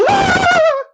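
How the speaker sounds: shrill startled shriek, loud; high frightened scream. Acted. Scared skunk caught hiding, screaming high. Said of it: "screamed the scared skunk".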